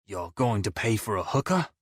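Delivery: ordinary delivery, nothing marked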